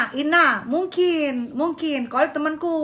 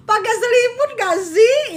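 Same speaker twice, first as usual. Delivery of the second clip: laughing question; 7 semitones higher, 5 dB louder than usual. Real, not acted. Asks while laughing, higher.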